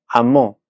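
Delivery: amó, weight on the final mó; a-MÓ, second syllable stressed; stress on last syllable. Shown as a demonstration. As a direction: push the ó at the end of amó. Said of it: "en la última sílaba".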